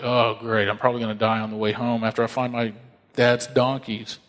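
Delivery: low-pitched, resigned mock dread; deadpan dismay. Saul thinks he'll die on the way home, deadpan dismay. Saul's imagined dread at the news.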